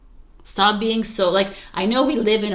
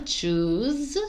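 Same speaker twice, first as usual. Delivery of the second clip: ordinary delivery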